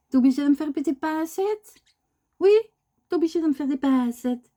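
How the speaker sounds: light high mock reproach; mock scolding pet. Real, not acted. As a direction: pretend to tell off the bird. Scolding bird for pinching.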